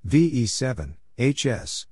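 ordinary delivery

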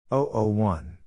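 normal talking voice

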